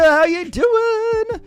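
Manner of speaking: high, slow, drawn-out hype shout; hyped shout-out. Real, not acted. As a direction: belt the greeting like a hype man. Hyped greeting to chat.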